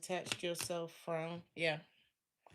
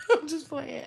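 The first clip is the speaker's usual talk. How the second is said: laughing; 10 semitones higher, 9 dB louder than usual. Real, not acted. Laughs after joke.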